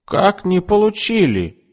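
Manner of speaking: high, astonished outcry; can't believe her ears; indignant exclamation. A narrator's reading. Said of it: "воскликнула мисс Рейнвотер". (высокое изумлённое восклицание)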